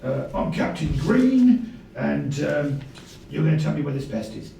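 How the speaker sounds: loud, commanding captain; captain character. Acted. Player introduces himself as captain.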